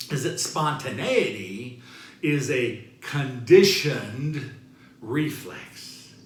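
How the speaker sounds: normal talking voice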